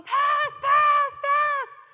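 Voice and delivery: put-on high, full-volume child's yell for the ball. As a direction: yell it out over the pitch, very high. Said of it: "Achintya was yelling".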